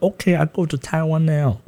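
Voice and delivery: put-on high, lightly accented broken English; mock Chinese accent. Son mocks his own reply to mom in a Chinese accent. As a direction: play it as a halting Chinese-accented resolve. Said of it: "I was like".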